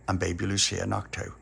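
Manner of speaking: plain reading voice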